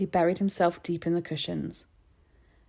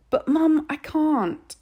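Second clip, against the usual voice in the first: pathetic; 10 semitones higher, 7 dB louder, much slower than usual. A narrator's reading. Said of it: "Harvey said pathetically".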